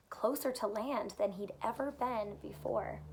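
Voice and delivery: usual speaking voice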